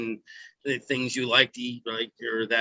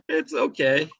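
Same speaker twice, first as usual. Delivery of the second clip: laughing; 10 semitones higher, slower than usual. Real, not acted. Laughs after being told off.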